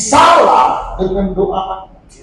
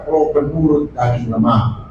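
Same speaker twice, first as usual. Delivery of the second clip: lower, lazy mock of the excuse; 10 semitones lower, 3 dB quieter than usual. Preacher imitating members skipping church, low mock excuse. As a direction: toss off the excuse like a shrug. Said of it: "Kenapa tidak datang gereja?".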